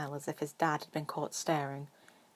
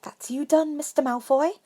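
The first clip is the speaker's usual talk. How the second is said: higher, louder cheery seamstress; cheerful shop witch, higher and louder; 10 semitones higher, 8 dB louder than usual. Acted. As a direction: sing it out brightly to the customer.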